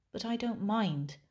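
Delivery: ordinary talk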